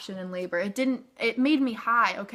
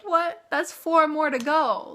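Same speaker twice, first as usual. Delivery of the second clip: high, loud, stunned What, then a slow dragged complaint; staggered to hear there's so much left; shocked disbelief; 7 semitones higher, 5 dB louder, slower than usual. Retold or imitated. Told by the nurse she's at six, shocked and dismayed.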